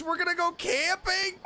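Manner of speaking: usual speaking voice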